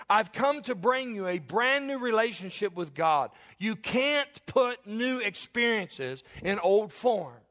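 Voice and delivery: loud, high, full-force preaching that swells; fired-up preaching. Acted. Preacher rallies his congregation, loud fired-up preaching. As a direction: preach it hard, and drag out the line about old forms.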